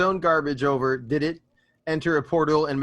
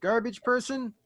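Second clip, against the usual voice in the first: half-hearted call-out; 7 semitones higher than usual. Acted. Driver calls up to the garbage person, mild mock yell. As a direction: call up to someone without really shouting. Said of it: "I'm gonna yell out".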